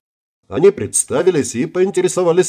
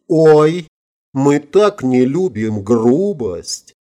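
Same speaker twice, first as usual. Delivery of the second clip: slow, drawn-out coy protest; acted fluttery dismay; mock innocence; 3 semitones higher, much slower than usual. A narrator's reading. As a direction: draw it out like a silly helpless girl. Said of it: "захлопала глазами Вильямс".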